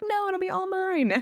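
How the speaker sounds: mock kid insisting it's hers; indignant child. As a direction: squeak it like an upset little girl. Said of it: "she was like".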